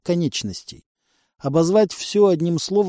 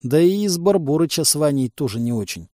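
plain reading voice

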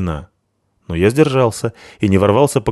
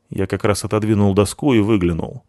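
usual speaking voice

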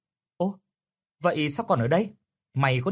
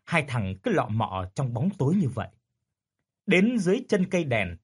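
ordinary delivery

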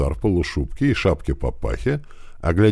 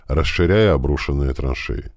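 plain reading voice

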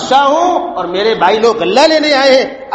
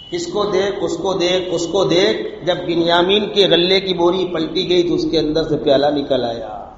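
ordinary delivery

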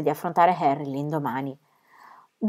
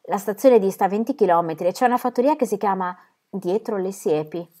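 plain reading voice

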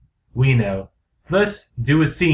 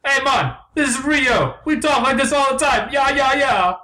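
very high mock Jamaican; hammed-up Jamaican bird, pitched way up; unconvincing Jamaican accent; 10 semitones higher than usual. Rio bird answers Blue, high mock Jamaican accent.

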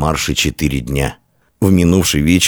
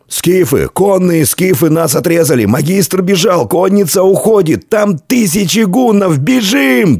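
high-pitched cries climbing higher; panicked shouting; 13 semitones higher than usual. A narrator's reading. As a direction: cry out as if fleeing for life. Panicked cries reach commander.

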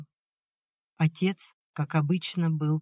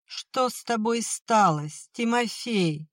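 higher, slow keening plea; lamenting wail; 6 semitones higher, slower than usual. Acted. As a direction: wail his name in grief. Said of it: "запричитала мать".

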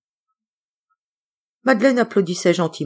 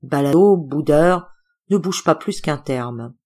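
plain reading voice

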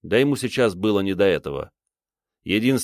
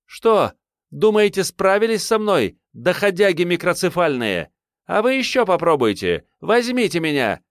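much higher, screaming taunt at attackers; defiant scream; 10 semitones higher, 3 dB louder than usual. A narrator's reading. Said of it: "закричала во весь голос".

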